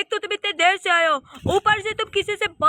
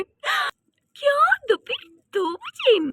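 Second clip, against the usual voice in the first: drawn-out high startled क्या; shocked what; 10 semitones higher, much slower than usual. Acted. Daughter reacts to her father's plan, drawn-out and startled. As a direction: stretch the क्या in disbelief. Daughter hears the movie time.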